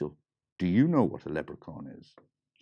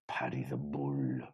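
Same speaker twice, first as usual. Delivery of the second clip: whispered name of the bull; hushed; 7 semitones lower, 6 dB quieter than usual. A narrator's reading. Storyteller hushes naming the bull.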